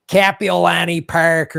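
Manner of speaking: "Kapiolani Park" in broad, slow Chicago vowels; Chicago accent. Shown as a demonstration. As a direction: exaggerate a Chicago dialect, flat A in Kap. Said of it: "my Chicago dialect".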